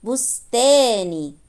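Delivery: بستاني slowly, long aa then brief ni; kasra ending. Shown as a demonstration. Teacher reading the word with kasra on nun, drawn-out aa in بستاني. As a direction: stretch the aa in بستاني and finish on ni. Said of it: "نون مكسورة".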